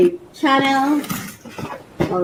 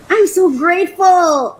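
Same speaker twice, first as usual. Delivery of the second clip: loud, higher-pitched emphatic thanks; heartfelt gratitude; 5 semitones higher, 5 dB louder than usual. Real, not acted. Vlogger stating thanks for her new pot, emphatic. Repeats thanks, more in control.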